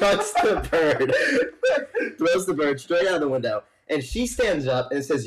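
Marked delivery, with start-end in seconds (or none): 0.0-1.1 slow, high, laugh-choked words; real helpless laughter; laughing through words. Real, not acted. Cracking up at punchline. 1.2-2.1 shrill burst of real laughter; real high-pitched cackle; high squealing laugh. Real, not acted. Punchline laughter. 2.2-3.6 higher, teary laughing voice; real laugh-crying; laughing through words. Real, not acted. Laughing retelling punchline.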